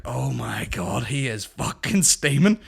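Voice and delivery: slow drawn-out shock. Retold or imitated. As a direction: stretch it out in disbelief. Shocked at a drunk driver.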